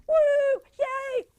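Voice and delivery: high, loud whoop of joy; secret inner cheer. Acted. Teenagers' hearts cheering inside, high excited whoop. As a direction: cheer in a high, gleeful squeal. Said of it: "Inside, their hearts are going".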